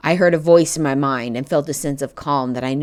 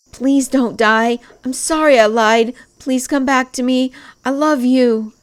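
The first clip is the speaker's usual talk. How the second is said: high, loud tearful begging; desperate pleading; 10 semitones higher, 5 dB louder than usual. A narrator's reading. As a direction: beg him to come back. Begging dying lover.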